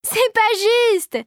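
high, slow, laughing complaint; laughing protest. A narrator's reading. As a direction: stretch it out through giggles. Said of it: "s'esclaffa Marie".

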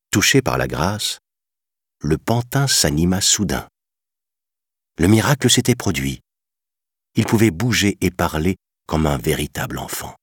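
usual speaking voice

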